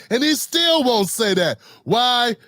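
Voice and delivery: ordinary talk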